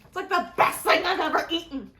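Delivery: high, loud rave; shrieking jackpot winner. Real, not acted. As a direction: gush like you just won. Raving about the chocolate apple.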